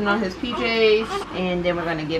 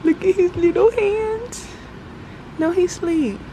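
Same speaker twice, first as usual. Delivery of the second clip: cooing; 10 semitones higher, slower than usual. Real, not acted. Cooing over sleeping baby.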